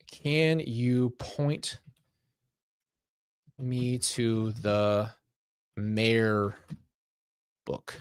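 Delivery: very slow, words drawn out with long gaps; halting, odd phrasing. Real, not acted. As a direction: feel your way haltingly through each word. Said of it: "That's a weird way to say this".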